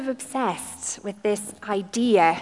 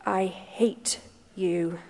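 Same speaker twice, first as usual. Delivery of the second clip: quiet bitter voice; 2 semitones lower, 5 dB quieter, much slower than usual. Acted. Girl refuses to look up.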